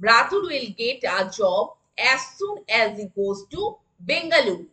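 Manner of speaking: very slow, word by word; slow classroom dictation. Shown as a demonstration. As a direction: spell it out slowly for the class. Teacher repeats the sentence.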